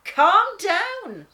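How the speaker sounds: loud, high call to calm her; sudden loud plea for calm. Acted. Lion calms his snarling sister.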